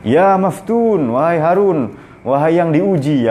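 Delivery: slow, high, drawn-out call. Acted. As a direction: chant the king's titles as a teasing summons. Bahlul calls out to the king.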